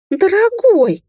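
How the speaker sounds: very high, loud squeal; frightened squeak. A narrator's reading. She-wolf appeals to her man, frightened squeak. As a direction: squeak it in alarm to a lover. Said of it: "испуганно пропищала волчица".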